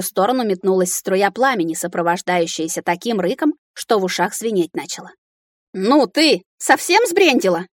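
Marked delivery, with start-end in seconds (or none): 5.7-7.7 raised, high outraged question; exclaimed with pitch climbing; indignant cry. A narrator's reading. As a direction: call out in indignation. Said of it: "выкрикнула я".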